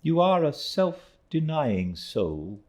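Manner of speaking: put-on high, slow elderly aunt; aunt's voice. A narrator's reading. Aunt praising Mister Peggotty, high slow voice.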